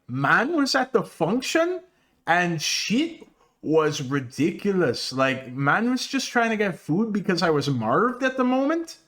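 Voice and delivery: mock Toronto accent, slow start; imitating Torontonians, slow and slangy; Toronto slang. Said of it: "you're gonna hear people go like".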